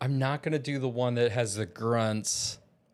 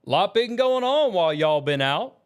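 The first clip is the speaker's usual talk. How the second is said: Berserker Sissy; 5 semitones higher, 4 dB louder than usual. Acted. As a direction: ask it as a Southern berserker. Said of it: "in the Southern accent".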